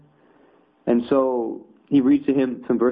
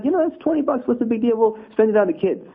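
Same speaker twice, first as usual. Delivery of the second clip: high-pitched, casual coaxing; tempting Shaitan; 8 semitones higher than usual. Acted. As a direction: talk them into it, voice pitched up. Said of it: "Shaitan comes and says".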